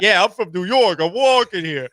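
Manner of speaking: put-on a cabbie who's been cut off, New York accent; mock New York accent. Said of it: "doing impressions of people from".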